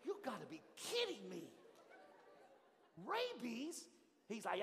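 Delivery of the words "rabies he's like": ordinary delivery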